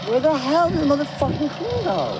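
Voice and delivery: high, exasperated cursing at a lost item; frustrated yelling. Real, not acted. Searching the room for the lost cream, exasperated high cursing. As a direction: wail it out, fed up and high.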